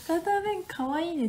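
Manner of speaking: sing-song "博多弁かわいいね"; playful echo. Shown as a demonstration.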